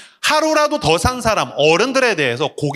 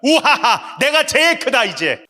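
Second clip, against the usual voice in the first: boastful gloat; 7 semitones higher, slower than usual. Retold or imitated. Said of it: "그 얘기를 하고 싶었던 거예요".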